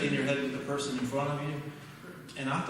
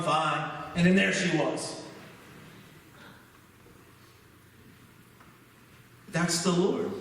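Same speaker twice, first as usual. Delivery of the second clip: higher, emphatic pulpit delivery; preaching; 4 semitones higher, 3 dB louder than usual. Real, not acted. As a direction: drive the lesson home to the congregation. Preaches through his testimony.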